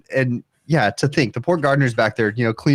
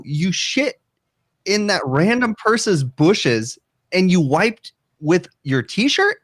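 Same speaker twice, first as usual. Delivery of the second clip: incredulous disbelief; 7 semitones higher, much slower than usual. Retold or imitated. Disbelief at brother's wiping.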